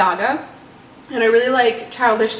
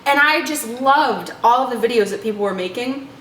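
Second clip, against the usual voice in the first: ordinary talk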